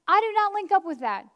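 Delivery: loud, high, firm refusal; bold declaration. Acted. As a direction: declare it over the fear. Preacher's declaration against fear.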